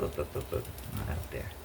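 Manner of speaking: normal talking voice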